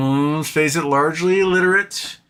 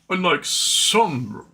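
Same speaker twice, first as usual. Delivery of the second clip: put-on higher, slowly drawn-out British accent; 4 semitones higher, much slower than usual. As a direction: put on a slow British film-hero voice. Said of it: "where Carrie Ewell's, he's like".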